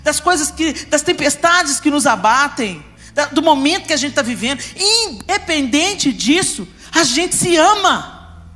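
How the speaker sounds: fervent pulpit preaching; impassioned preaching. Acted. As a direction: drive it home to the congregation. Preaching with rising intensity.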